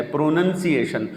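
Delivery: slow پروننسیشن as "pro-nun-si-ation"; "nun" in middle of پروننسیشن; short vowel in second syllable of پروننسیشن; correct pronunciation. Shown as a demonstration. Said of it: "پروننسیشن ہے".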